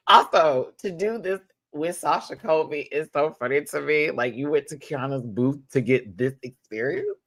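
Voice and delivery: ordinary talk, nothing marked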